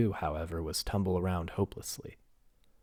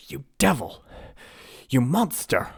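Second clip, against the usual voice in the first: high, loud furious cries; angry insults; 8 semitones higher, 8 dB louder than usual. Acted. Prince shouting insults at the giantess. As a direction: spit the insults at her.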